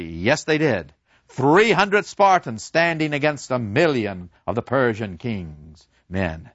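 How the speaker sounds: ordinary delivery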